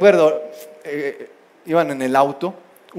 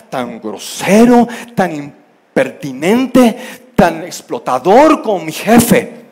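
fed up, angry; 7 dB louder than usual. Acted. Employee complains to pastor about an abusive boss, fed up. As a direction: rant like someone at the end of their rope. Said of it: "usted estaría igual o peor de enojado, cansado, harto".

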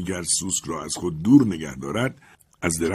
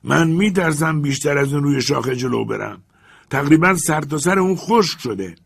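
fearful servant; 6 semitones higher, 5 dB louder than usual. Acted. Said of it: "صدای ژوپیتر شنیده شد".

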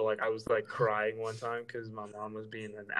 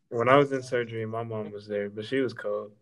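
ordinary talk

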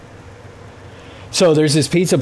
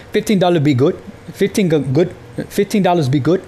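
higher-pitched bad Italian accent that slides into Indian; mock Indian-Italian accent; 5 semitones higher than usual. Customer mimics the pizza guy's pricing, mock Indian-Italian accent. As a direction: imitate a condescending Italian in a muddled Indian-sounding accent. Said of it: "a little bit more of an Indian accent than Italian".

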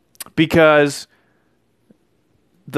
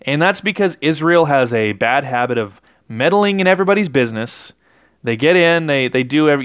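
ordinary talk

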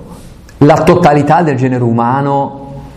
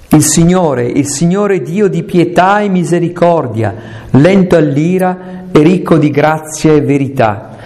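slow, raised voice proclaiming scripture; solemn scripture reading; 5 semitones higher than usual. Acted. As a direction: read it out like a proclamation from the pulpit. (lettura solenne e lenta della Scrittura)

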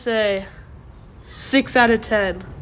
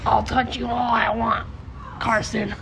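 Caden impression. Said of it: "I'll do my Caden response".

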